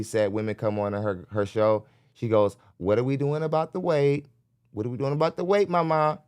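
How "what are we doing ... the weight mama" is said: high, sweet imitation Jersey girl; lilting mock Jersey girl voice; Jersey girl talk. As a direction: mimic a Jersey girl's sweet nagging, pitched way up. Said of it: "You know how them Jersey girls talk".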